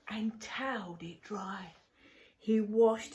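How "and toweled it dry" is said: soft whispered echo of the line. Shown as a demonstration. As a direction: breathe the words without voice. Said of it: "repeated as a whispered echo".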